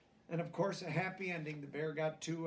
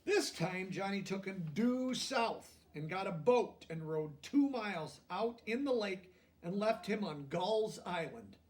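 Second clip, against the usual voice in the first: gentle storybook reading, a touch higher; lively picture-book narration; reading to children; 5 semitones higher, 7 dB louder, slower than usual. A narrator's reading. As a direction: read it like a bedtime story to kids.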